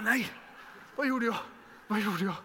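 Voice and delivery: high, loud alarmed cry; panicked regret. Retold or imitated. Preacher reliving her panic after answering a threat, alarmed cry. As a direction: gasp it out in sudden horror.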